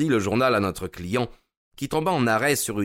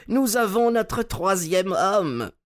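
voix haute et joyeuse, lente; ravi; 10 semitones higher, slower than usual. A narrator's reading. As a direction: savourer la nouvelle, aigu. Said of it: "cria notre hôte". (l'hôte se réjouit du troisième Garideb)

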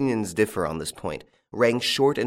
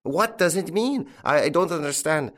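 raised pitch, baffled questioning; bewildered alarm; 7 semitones higher than usual. A narrator's reading. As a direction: repeat it in confused alarm, voice pitched up. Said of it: "The Colonel repeated".

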